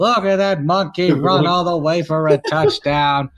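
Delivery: sportscaster calling a run in a measured, punched cadence; old-time play-by-play voice, hitting each phrase; Howard Cosell. Acted. Mock broadcaster calling the game for friends, Howard Cosell impression.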